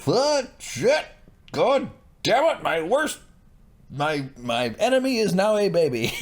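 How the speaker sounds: high-pitched outraged outburst. Retold or imitated. Voicing Ramsay's reaction to baby.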